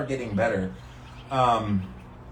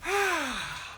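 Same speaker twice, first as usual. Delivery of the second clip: relieved high sigh; 4 dB quieter than usual. Real, not acted. Relief after ice bath.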